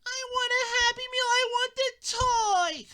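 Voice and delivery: put-on high whiny kid voice; nagging kid. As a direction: keep nagging the parent. Kid keeps nagging parent.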